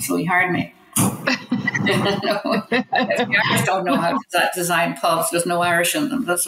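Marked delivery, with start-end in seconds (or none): 0.3-4.5 speaking slowly through a laugh; amused and slow. Real, not acted. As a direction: laugh the joke out, unhurried. Joking about Irish pubs.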